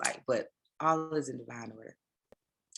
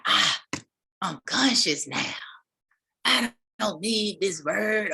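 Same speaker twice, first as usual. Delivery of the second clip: hammed-up mock of a smug convert; mocking the "conscious"; 4 semitones higher, 4 dB louder, slower than usual. Mocking people who burn Bibles, smug mock voice. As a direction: sneer it like someone too enlightened for the Bible. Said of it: "talking about".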